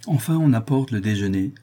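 ordinary delivery, nothing marked

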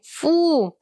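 high, drawn-out yuck; boy's disgust. A narrator's reading. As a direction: squeal it in playful disgust. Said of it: "сказал Эрнест".

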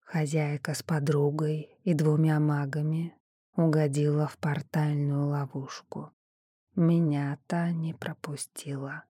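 soft unvoiced whisper. A narrator's reading. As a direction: breathe it as a mind voice. Shadow speaks telepathically.

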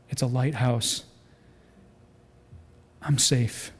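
low, hushed relief; relieved captain. Acted. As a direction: let the voice drop softly into safety. Captain sees lighthouse, relieved.

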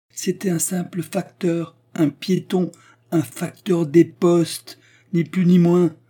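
high, emphatic enunciation; pointed, high-pitched precise delivery; crisply articulated. A narrator's reading. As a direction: spell out each phrase distinctly. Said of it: "articula nettement Frau von Treumann".

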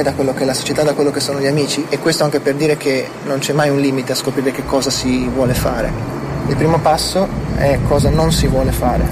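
normal talking voice

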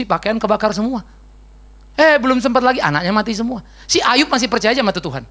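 ordinary talk

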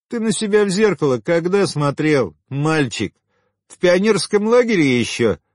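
high-pitched, derisive woman's taunt; belittling, as if to a little boy; scornful mockery. A narrator's reading. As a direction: cut him down with high, mocking questions. Woman mocks unwanted suitor.

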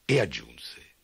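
plain reading voice

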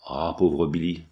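plain reading voice

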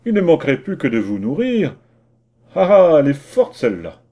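loud, outraged scoff; indignant. Acted. Indignant mayor refusing to feed the vagabond, outraged. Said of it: "L'autre fut indigné".

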